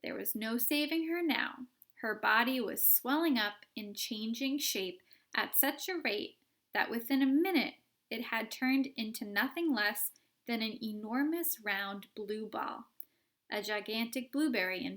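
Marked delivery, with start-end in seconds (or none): none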